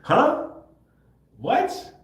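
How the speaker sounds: sudden high, loud disbelief; shocked. Retold or imitated. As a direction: jolt up in pitch in alarm. Shocked at being sent back.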